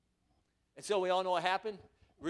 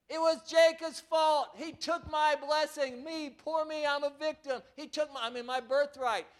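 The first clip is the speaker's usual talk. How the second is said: whiny self-pity; 7 semitones higher, 3 dB louder than usual. Retold or imitated. Preacher mocking Esau's whining, high self-pitying whine. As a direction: whine like a sulking victim. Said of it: "Look at Esau's attitude".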